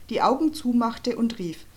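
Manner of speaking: usual speaking voice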